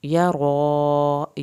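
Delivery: "یرا" with a heavy r and a very long a; stretched vowel. Shown as a demonstration. Teacher to students: very slow, heavy r. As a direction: drag the a in "یرا" out. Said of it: "را کو پر کر کے اور کھڑی زبر کو کھینچ کے".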